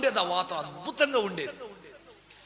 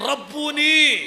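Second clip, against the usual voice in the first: loud, high cry of recognition; Mary cries out to Jesus in joyful recognition; 6 semitones higher, 7 dB louder, slower than usual. Retold or imitated. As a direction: burst out with joy on seeing him.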